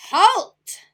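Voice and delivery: very loud, high bellow; deafening bellow. Acted. Rat bellowing at the ants, deafening. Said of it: "who bellowed a deafening".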